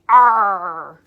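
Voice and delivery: loud gruff pirate growl; pirate arr. Shown as a demonstration. Teacher showing kids how pirates talk, gruff pirate growl. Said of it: "Can you guys talk like a pirate?".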